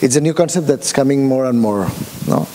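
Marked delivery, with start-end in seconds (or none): none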